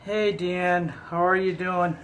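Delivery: usual speaking voice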